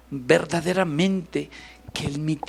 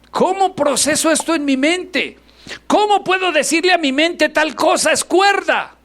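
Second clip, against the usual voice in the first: high, loud pleading questions; fervent preaching; 7 dB louder than usual. Acted. Pastor challenging the church, loud fervent preaching. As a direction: pour it out to the congregation.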